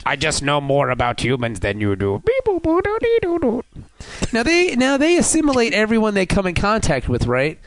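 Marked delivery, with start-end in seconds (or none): 0.0-3.6 toy robot voice. Pretend. Robot Picard boasts.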